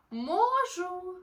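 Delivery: high, loud happy cry; cheerful triumphant shout; joyful exclamation. Acted. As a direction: burst out with new courage. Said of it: "весело вигукнув".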